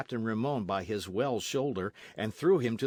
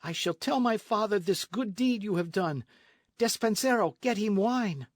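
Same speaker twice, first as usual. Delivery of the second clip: put-on much higher, eager girlish voice; grateful young lady; 11 semitones higher, 4 dB louder than usual. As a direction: gush thanks to her rescuer. Lolita's voice.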